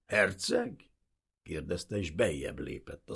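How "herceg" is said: high, rising scornful echo; incredulous, derisive lift; mocking question. A narrator's reading. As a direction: sneer the title back as a question. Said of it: "arca megrándult gúnyosra".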